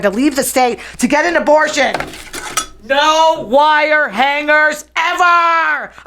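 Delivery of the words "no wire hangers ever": high, very slow shouted line; shouted quote. Acted. She rants against abortion bans with a shouted quote. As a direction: hammer it out like a movie-mother shriek. Quoting Mommie Dearest, abortion rant.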